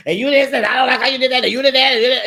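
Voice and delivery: fast, high, loud pile-on; imitating someone coming on too strong; rapid-fire mock rant. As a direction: rattle off complaints breathlessly. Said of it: "coming on too strong".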